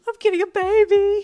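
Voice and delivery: very high, loud excited squeal; excited blurting. A narrator's reading. Friend announces his big news, blurting it excitedly. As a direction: blurt it out, bursting with joy. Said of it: "he blurted out excitedly".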